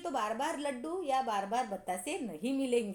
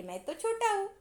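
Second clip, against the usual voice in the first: a hint of a little boy, higher; small child; 7 semitones higher than usual. Anil excuses himself to the teacher, lightly voiced little boy. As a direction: say it like a small innocent kid. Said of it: "उन्होंने क्या कहा कि".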